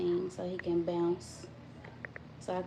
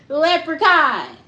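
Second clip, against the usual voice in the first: loud leprechaun; 6 semitones higher, 12 dB louder, slower than usual. Real, not acted. Insisting to viewers, calls Leprechaun out loudly. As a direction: shout the word Leprechaun high and slow. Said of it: "You heard me?".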